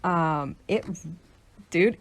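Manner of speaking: usual speaking voice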